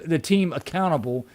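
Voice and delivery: ordinary delivery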